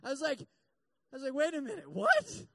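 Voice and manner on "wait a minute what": high and loud, rising to a startled yelp; jolted by sudden scary news; shocked outburst. Retold or imitated. A patient hearing frightening news from the doctor, shocked yelp.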